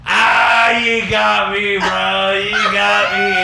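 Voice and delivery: slow, loud mock surrender; caught out, laughing concession. Caught by the cook, a loud drawn-out "you got me". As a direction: throw up your hands, loud and slow.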